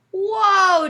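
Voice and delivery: high, loud amazed whoa. Acted. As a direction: gasp it out in wonder. Jimmy amazed at big fish.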